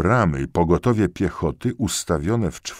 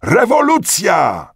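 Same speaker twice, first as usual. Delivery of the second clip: high, loud, drawn-out shout; crowd's cry; 7 dB louder, slower than usual. A narrator's reading. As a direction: stretch the word into a mass cheer. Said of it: "rozległy się okrzyki". (przeciągły okrzyk tłumu)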